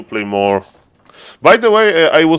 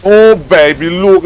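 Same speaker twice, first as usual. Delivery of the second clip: higher, louder gleeful outburst; thrilled; 6 semitones higher, 8 dB louder than usual. Real, not acted. Player reacting to a big moment in his game, thrilled. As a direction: burst out loud and high with excitement.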